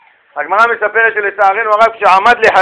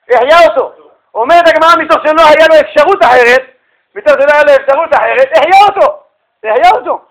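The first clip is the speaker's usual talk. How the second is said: loud, high-pitched storytelling climax; amazed exclamation; 5 semitones higher, 5 dB louder than usual. Real, not acted. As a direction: raise the voice high in wonder at the miracle. Amazed telling of the revival. (התפעלות בקול רם וגבוה)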